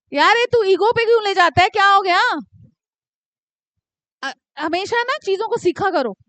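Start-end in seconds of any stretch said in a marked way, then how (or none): 0.1-2.2 firm scolding. Real, not acted. Scolding a sulking student.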